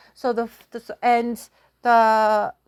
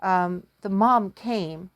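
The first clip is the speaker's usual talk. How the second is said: ordinary delivery, nothing marked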